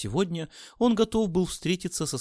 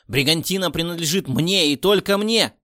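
loud possessive outburst; angry shout; 8 dB louder than usual. A narrator's reading. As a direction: shout it, jealous and furious. Boss claims the company.

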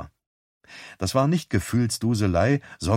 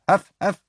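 imitated bark; 10 semitones higher, 3 dB louder than usual.

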